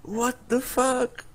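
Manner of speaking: stunned. Real, not acted. Stunned at the win.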